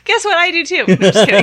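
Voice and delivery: blurting it out way too loud; outside voice. Real, not acted. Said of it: "That's my outside voice".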